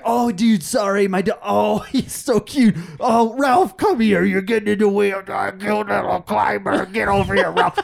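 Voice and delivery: high, loud doting dog owner; hammed-up oblivious owner calling his dog; guy with dogs. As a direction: gush and fuss over an unruly dog. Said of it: "I'm gonna be the guy with the dogs".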